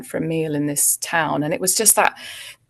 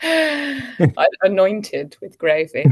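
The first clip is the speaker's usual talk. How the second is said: laughing; 2 semitones higher, 3 dB louder than usual. Real, not acted. Joking about gravy in grave.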